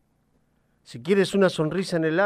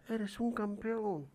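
softer, slow distant echo; faint echo; 7 semitones higher, 10 dB quieter, slower than usual. A narrator's reading. The mountain echoes the father, faint slow echo. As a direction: repeat it back from far away. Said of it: "del otro lado le contestan".

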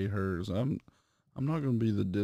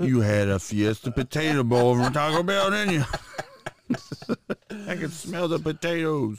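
mock wife; 7 semitones higher, 5 dB louder than usual. Mocking the wife's voice.

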